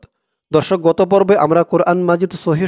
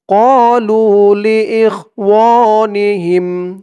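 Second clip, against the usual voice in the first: slow chanted phrase, higher; slow recitation; 4 semitones higher, 4 dB louder, much slower than usual. Shown as a demonstration. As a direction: build the words up, recited. Builds up the phrase.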